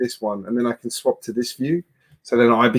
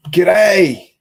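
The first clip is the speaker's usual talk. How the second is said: loud, high Australian "G'day!"; exaggerated Australian greeting; 7 semitones higher, 7 dB louder than usual. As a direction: call out a hammed-up Aussie "G'day!". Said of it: "That's Australian in my best English accent".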